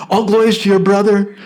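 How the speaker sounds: all glories to your brother, with a chuckle; cheerful laughing blessing. Acted. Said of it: "They spoke like that".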